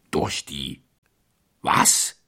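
low start, then a loud, puzzled WAS; baffled echo. A narrator's reading. Thief questions the priest's unknown slang, baffled. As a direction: echo it haltingly, then burst out on the last word.

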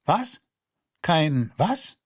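höher, ungläubiges Nachfragen; ungläubig nachfragen, das Was hochgezogen; verblüfft. Acted. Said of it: "fragte man im Flur". (die Leute im Flur fragen verblüfft nach)

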